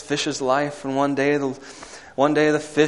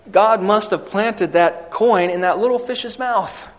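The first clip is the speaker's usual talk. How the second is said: loud, fast, high sermon climax; fiery preaching; 8 semitones higher, 7 dB louder than usual. Acted. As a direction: preach it out with full force. Preaching at full force.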